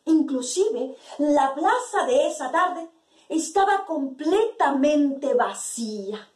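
usual speaking voice

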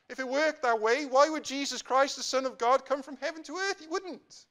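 high, loud rhetorical challenge; forceful preaching. Real, not acted. Preacher confronting a young man's trust in works, forceful preaching. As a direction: drive the argument home from the pulpit.

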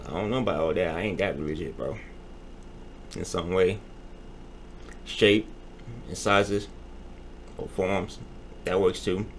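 low, soft grumbling; muttering quietly. Real, not acted. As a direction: mumble it half to yourself. Muttering back to the entity.

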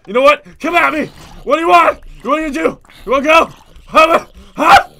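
loud, rising come-at-me taunts; taunting challenge. Real, not acted. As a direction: dare the monster to fight. Taunting the monster.

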